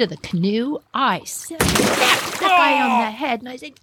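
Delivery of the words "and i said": ordinary talk, nothing marked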